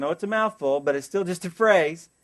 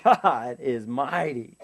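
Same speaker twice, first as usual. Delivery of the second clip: mock awe; 4 semitones lower, much slower than usual. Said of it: "we'll come away and say".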